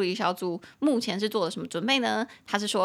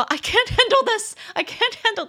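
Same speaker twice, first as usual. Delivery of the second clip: high, loud, despairing wail; overwhelmed cry; 12 semitones higher, 6 dB louder than usual. Shown as a demonstration. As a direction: squeal that you can't cope. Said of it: "那如果你不行".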